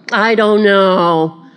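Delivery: slow, exaggerated wondering; mock pondering. Mocking Balaam's need to pray about cursing, sarcastically pondering. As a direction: stretch it out in feigned uncertainty.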